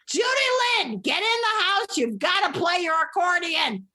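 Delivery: high, insistent mock call; mother calling out. Mother orders daughter in to practice accordion, high calling-out voice.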